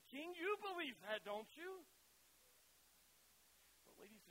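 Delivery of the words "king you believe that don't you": high, loud urging; pressing question. Acted. Paul pressing the king, high and loud. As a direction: lean on the king for an answer. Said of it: "he says".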